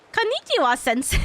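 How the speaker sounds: Konnichiwa jumps high and bright, sensei drops. Retold or imitated. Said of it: "It's the high pitch immediate that kills me".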